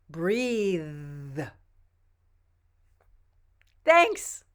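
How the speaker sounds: normal talking voice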